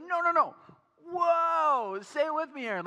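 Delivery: very high, loud, ecstatic whoa; overwhelmed with wonder like the viral video; wife urging husband to feel the awe; double rainbow guy awe. Retold or imitated. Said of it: "double rainbow guy".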